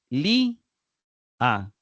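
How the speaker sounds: "li, a" as two separate syllables, the first accented; stress on li. Shown as a demonstration. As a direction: punch "li", pause, let "a" fall. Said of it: "está acentuado en la penúltima sílaba".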